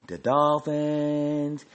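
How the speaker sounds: "Dolphins" stretched out very slowly; drawn-out emphasis. Real, not acted. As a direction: drag out "Dolphins" in disbelief. Scornfully listing worse teams.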